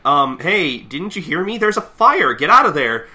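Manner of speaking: high, loud warning to someone not listening; exasperated mock alarm; urgent yelling. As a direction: shout them out of danger. Acted warning yelled at old man.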